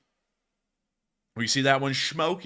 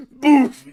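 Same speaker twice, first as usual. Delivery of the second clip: mock boom; 11 semitones higher, 12 dB louder than usual. Imitating the explosion.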